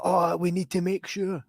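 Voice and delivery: a touch high, slow and fussing; high fussy voice. Retold or imitated. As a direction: fuss over it gently. Voicing mum.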